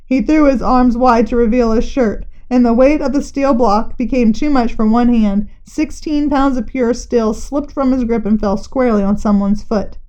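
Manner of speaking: ordinary delivery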